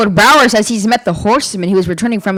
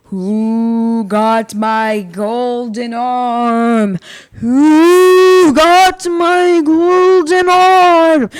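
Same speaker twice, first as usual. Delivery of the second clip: slow, drawn-out moaning ghost; slow spectral moan; eerie ghost voice; 3 semitones higher, much slower than usual. Acted. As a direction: stretch every word like a ghost searching. Ghost story creature voice.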